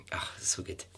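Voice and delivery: whispered aside. Real, not acted. Host whispering a pleased aside to listeners. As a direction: breathe it to yourself, pleased.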